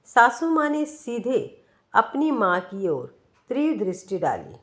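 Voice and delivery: plain reading voice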